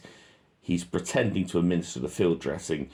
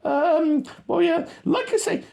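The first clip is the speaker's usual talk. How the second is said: much higher, louder mock radio talk; squeaky radio voice; 6 dB louder than usual. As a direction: mimic a soldier on the radio, squeaky. Radio voice again.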